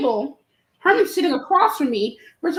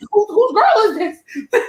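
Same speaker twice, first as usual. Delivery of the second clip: slow, laughing puzzled question; 6 semitones higher, slower than usual. Retold or imitated. To her friends about the woman, slow and laughing. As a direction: drawl it out through a laugh. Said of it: "And I was like".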